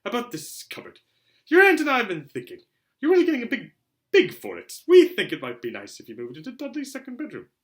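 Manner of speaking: high, loud, strained sweetness; forced friendliness. Acted. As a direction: fake a smile through clenched nerves. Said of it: "forced his face to a smile".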